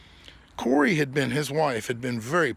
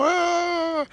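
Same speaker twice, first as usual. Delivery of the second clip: gloating taunt; 3 dB louder than usual. Retold or imitated. Philly gloating in his face.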